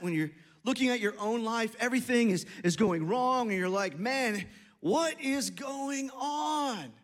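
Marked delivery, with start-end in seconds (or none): 4.0-6.9 slow, drawn-out exasperation; exasperated plea. Acted. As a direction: stretch each word in mock despair at God. Acted complaint to God.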